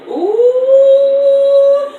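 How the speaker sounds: high, loud, drawn-out ooh; mock shocked ooh. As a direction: gasp out a theatrical ooh. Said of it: "So I was just like".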